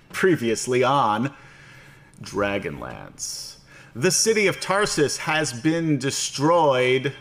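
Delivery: slow, grand trailer-style narration; dramatic recap narration. Acted. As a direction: announce it like an epic recap. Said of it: "I don't know why I'm saying it like that".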